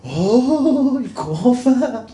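long, drawn-out high shout. Retold or imitated. Mufazzal shouting at the unbeliever, long and loud. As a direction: bellow it slowly across the shrine. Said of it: "داد زد".

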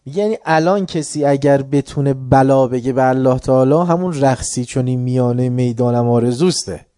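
usual speaking voice

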